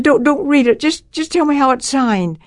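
high, anxious stammered plea; scared. Retold or imitated. Girl begging the doctor about an unopened letter, scared. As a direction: re-enact a frightened girl begging, pitched well up. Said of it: "I was so scared".

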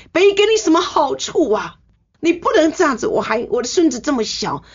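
father-in-law begging her not to risk her life, high, upset scolding; angry father-in-law. Retold or imitated. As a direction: imitate an upset old man pleading and scolding, high-voiced. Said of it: "他就很生气".

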